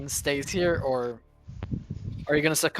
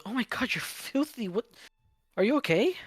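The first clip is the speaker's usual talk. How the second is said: higher, fussing mom voice; worried mother; 7 semitones higher, 3 dB quieter than usual. Acted. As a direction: fuss over him like a mother. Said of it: "and she goes".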